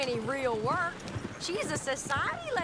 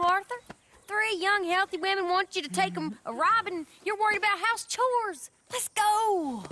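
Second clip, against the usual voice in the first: ordinary delivery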